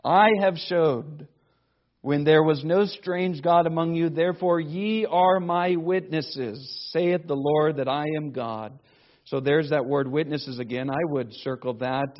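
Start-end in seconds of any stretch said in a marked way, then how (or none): 0.0-8.8 louder, solemn reading of scripture. Acted. Preacher reads scripture aloud.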